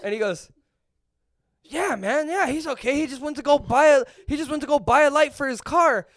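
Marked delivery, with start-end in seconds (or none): 1.6-6.1 higher-pitched, casual dad voice; imitating a friend's chatty dad; rambling dad. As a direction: ramble on, breezily unconcerned. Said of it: "he's just rambling".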